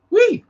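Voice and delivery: high, loud "wee"; short high-pitched gleeful squeal; playful squeal. Real, not acted. Streamer winding down the night, squealing a high "wee".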